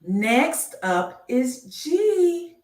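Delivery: slow sing-song reveal of the next letter; drawn-out announcement. Acted. Slow game-show style letter reveal.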